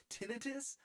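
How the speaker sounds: "Tinnitus?" as TIN-uh-tus, high and rising; disgusted first-syllable stress. Shown as a demonstration. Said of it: "makes me feel gross".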